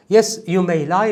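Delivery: usual speaking voice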